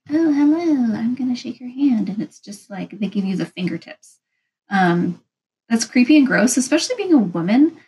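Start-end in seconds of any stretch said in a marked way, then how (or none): 0.1-2.1 higher-pitched mock timid greeting; limp-handshake greeter. Vlogger mimics someone offering a limp handshake, higher mock-greeting voice. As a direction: imitate a wet-fish handshaker saying hello. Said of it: "the person who comes up to you and is like".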